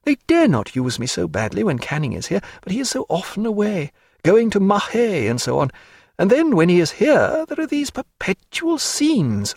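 a hint of a lively lady mocking her paint; Diana. A narrator's reading. Narrator voicing Diana.

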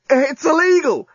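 high, drawn-out delight; gleeful excitement. A narrator's reading. Journalist grinning ear to ear, excitedly confiding. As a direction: grin through the words. Grinning alien amid lovemaking.

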